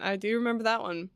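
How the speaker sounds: ordinary talk, nothing marked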